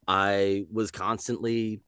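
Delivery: ordinary talk, nothing marked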